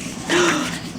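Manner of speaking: sharp unvoiced gasp; a storyteller's dramatic gasp. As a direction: draw in a startled breath.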